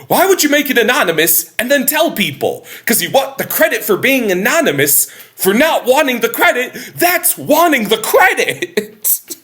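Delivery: Larry ranting about Ted Danson taking credit; mock incensed rant. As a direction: overdo the indignant complaint. Larry rants about anonymous donor.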